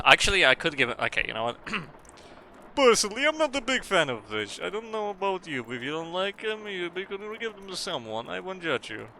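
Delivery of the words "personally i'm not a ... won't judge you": high, soft Russian-accented beaver voice. Shown as a demonstration. Streamer auditioning a Russian beaver, high Russian accent. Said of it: "or do you like Russian beavers more?".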